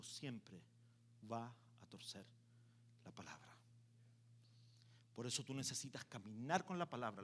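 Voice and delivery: hushed preaching. Acted. Preacher sinks to near whisper.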